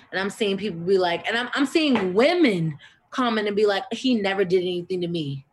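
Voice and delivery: loud, heated indignation; fed-up, furious, loud; angry rant. Real, not acted.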